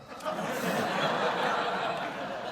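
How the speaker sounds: breathy high laugh at the memory; amused laugh. Real, not acted. Recalling Brando's screen test, a high laugh.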